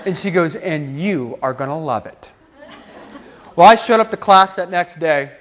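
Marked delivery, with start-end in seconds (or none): 0.6-2.3 lower, softer, knowing reply; assured comeback. Retold or imitated. Professor answers student's insult, quietly certain. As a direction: drop down and promise it with certainty.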